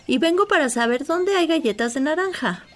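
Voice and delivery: high, eager little dog voice; little dog Lulú. Acted. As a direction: lift into the small dog's voice.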